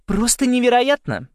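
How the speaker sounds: high, loud gushing cut-in; emphatic interruption. A narrator's reading. Уинтер answers for Саймон about the school, cutting in brightly. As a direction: burst in over him, pitched high. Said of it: "перебила его Уинтер".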